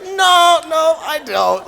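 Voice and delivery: high, loud mock horror; alarmed refusal. Teacher answering a child who would taste him, loudly appalled. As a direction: exclaim it in exaggerated alarm.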